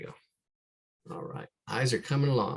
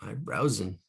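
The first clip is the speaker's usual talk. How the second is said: normal talking voice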